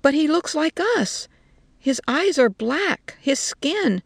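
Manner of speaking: disbelieving, high-pitched objection; astonished protest. A narrator's reading.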